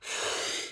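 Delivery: soft voiceless sigh of released breath; demonstrated exhale. Shown as a demonstration. Teacher showing students the breath, letting go with a sigh. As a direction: let the breath go softly. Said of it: "So this is".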